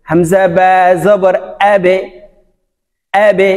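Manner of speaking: "আবে" released downward in a short e echo; downward qalqala. Shown as a demonstration. Teacher drilling "আবে" for learners, downward qalqala. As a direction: spell it out and push off the ব in "আবে". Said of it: "নিচের দিকে ধাবিত হবে".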